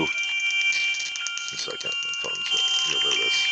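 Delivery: hushed, slow whisper off to the side; whispered aside. Real, not acted. Telling the call to wait a second, whispered. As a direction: drop to a whisper to deal with the phone. Aside while phone interrupts.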